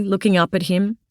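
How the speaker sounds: usual speaking voice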